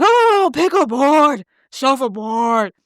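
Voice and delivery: loud, high, drawn-out mock questioner; mocking woman impersonation. Woman who asked the question, mocking impersonation. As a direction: wail the words slowly in mockery. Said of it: "the one that asked the question that was like".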